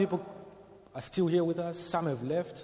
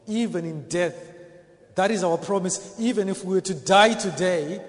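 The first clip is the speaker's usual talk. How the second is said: raised, louder preaching voice; forceful preaching; 4 semitones higher, 7 dB louder than usual. Real, not acted. Preacher assuring the congregation of Christ's promise, forceful preaching. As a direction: drive it home to the congregation, loud and high.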